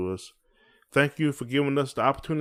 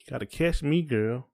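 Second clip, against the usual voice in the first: normal talking voice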